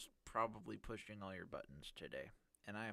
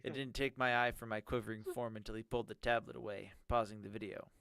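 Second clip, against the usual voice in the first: ordinary talk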